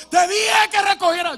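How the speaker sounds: high, loud yell of "Te dije que recogiera"; father bellowing at his children to tidy up; furious parent shout. Retold or imitated. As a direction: burst in like a madman yelling at the kids. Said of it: "entra con un palo como un loco".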